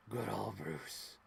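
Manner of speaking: slow, low whispery villain; slow hushed villain's fondness; quoted character. Pretend. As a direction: savor the name, sly and slow. Quoting character again.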